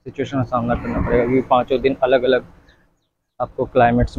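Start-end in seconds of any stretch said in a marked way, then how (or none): none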